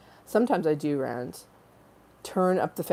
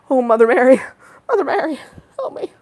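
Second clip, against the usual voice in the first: mock pleading cry; 8 semitones higher, 8 dB louder, faster than usual. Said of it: "so hot".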